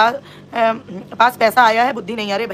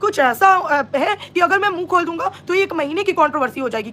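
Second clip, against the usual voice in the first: mock quoting a man; 5 semitones higher than usual. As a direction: mimic his teasing hints of inside news, pitched up. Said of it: "तो इसने ये बोला कि". (चिढ़ाते हुए ऊँचे सुर में नकल)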